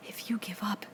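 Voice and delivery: whispered in dismay; appalled whisper. A narrator's reading. Piggy whispers to Ralph in horror. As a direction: breathe it out, appalled. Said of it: "in an appalled whisper".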